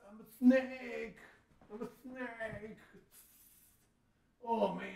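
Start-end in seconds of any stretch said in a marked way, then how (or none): 0.1-1.2 slow, high, loud hammed-up snake. Dad playing a snake in cobra pose, loud and hammy. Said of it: "you gotta make a snake noise". 1.7-2.8 slow high snake voice, pulled back in volume. Pretend. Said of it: "you gotta make a snake noise".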